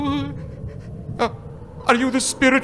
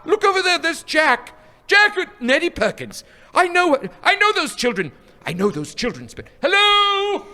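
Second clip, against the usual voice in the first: higher, eager calling out; light up at seeing old friends; delighted recognition; 7 semitones higher than usual. Acted. Scrooge spotting his boyhood friends, excited and eager.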